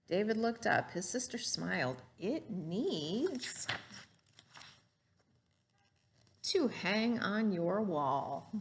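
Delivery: lively storybook read-aloud; gentle classroom story voice; reading to children. Acted. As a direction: read it like a picture book to a class. Teacher reads picture book aloud.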